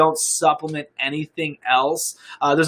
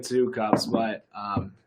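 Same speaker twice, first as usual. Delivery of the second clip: yawning; 6 semitones lower, 8 dB quieter, slower than usual. Real, not acted. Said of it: "I don't know why I'm yawning".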